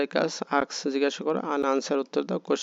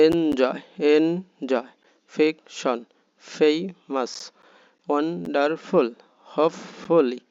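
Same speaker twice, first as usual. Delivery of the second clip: each word from enjoy to neighbourhood in firm, separated syllables; aloud, stressed syllables. Shown as a demonstration. Teacher models saying the words aloud, syllables stressed and separated. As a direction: punch and stretch the stressed part, as in টু-মো-রো. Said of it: "মানে জোরে জোরে".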